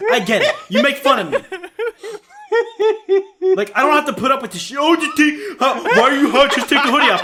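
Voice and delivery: high, loud whine, laughing all through; mock whiny rant. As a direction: play the wounded victim until the giggles win. Mock whiny rant about hoodie.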